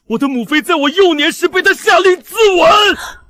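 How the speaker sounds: high, loud, drawn-out cry of outrage; anguished shout. Acted. Son revealing his father killed his mother, anguished shouting. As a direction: shout the terrible truth in agony, slowly.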